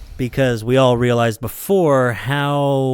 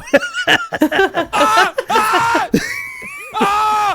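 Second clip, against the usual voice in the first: high hysterical laugh. Acted. Long high laughing shout.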